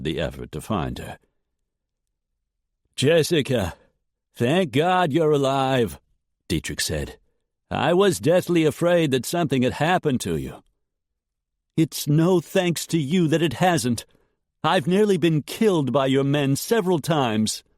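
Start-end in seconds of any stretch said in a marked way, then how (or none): 3.0-10.6 a hint of smooth relief; lightly voiced suitor. A narrator's reading. Dietrich calling to Jessica, mildly relieved. 11.8-17.6 put-on angry young woman. A narrator's reading. Jessica voiced, angry.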